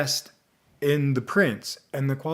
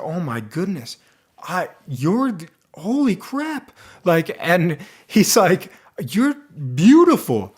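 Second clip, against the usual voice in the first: mock flustered smitten guy, rising higher and louder; overwhelmed; 5 semitones higher, 5 dB louder than usual. Movie prince blurts a compliment to the princess, overwhelmed. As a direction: imitate a man overcome by his emotions. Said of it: "no idea how to handle his emotions".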